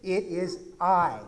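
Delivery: slow, weighty I AM; slow solemn declaration; solemn emphasis. Shown as a demonstration. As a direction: let each word land with divine weight. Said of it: "he also says this".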